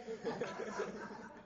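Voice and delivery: a quick laugh breaking the line; short laugh. Real, not acted. Comic amused at the two forks, chuckling. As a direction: chuckle through it.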